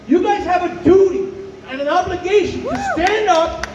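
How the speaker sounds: shouting. Real, not acted. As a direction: call the men to action, full voice. Rallying call to men.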